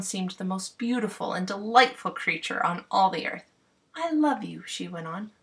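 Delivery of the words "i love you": slightly higher, adoring queen voice; a hint of a smitten queen; lightly voiced fairy queen. Titania voiced lovingly.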